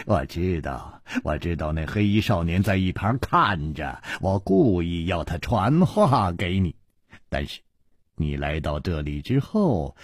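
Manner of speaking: low, smug smile in the voice; smiling. Acted. Nangong Ling explains his trick, smiling. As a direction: say it softly through a smile. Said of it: "南宫灵微笑着说".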